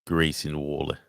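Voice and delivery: lightly voiced Australian accent. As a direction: say the name with an Aussie twang. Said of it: "my Australian accent".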